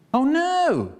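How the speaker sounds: high, loud wail of dismay; dismayed cry. A narrator's reading. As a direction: wail it in comic horror. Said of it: "transpector looked awful".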